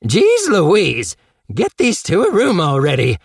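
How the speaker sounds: Harold snickering. Acted. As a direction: tease them loudly from the doorway. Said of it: "snickered Harold".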